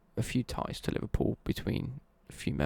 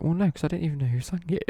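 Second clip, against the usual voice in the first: higher-pitched retold protest; mock protest; 6 semitones higher, 4 dB louder than usual. Retelling a chat with coworkers, mock protest. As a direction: act out a baffled objection, pitched up.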